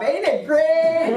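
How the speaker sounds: high, loud, drawn-out Southern drawl. Retold or imitated. Churchgoer who just lost a job, exaggerated Southern drawl. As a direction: imitate a gleeful Southern catchphrase, stretched out. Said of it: "I heard people start saying it".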